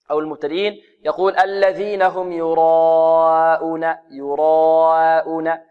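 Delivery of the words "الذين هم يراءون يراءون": "يراءون" long-held aa sliding from heavy to thin before hamza; beginner's thinned madd. Shown as a demonstration. Teacher imitating beginners' error, long vowel pulled thin. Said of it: "ويرقق الهمزة".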